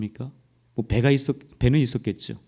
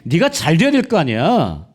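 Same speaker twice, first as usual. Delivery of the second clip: louder, sneering scoffer's jeer; mocking taunt; 5 semitones higher, 5 dB louder than usual. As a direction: taunt the believer like a mocking neighbour, slowing at the end. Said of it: "하나님을 조롱합니다". (크게 비웃으며 조롱하는 말투)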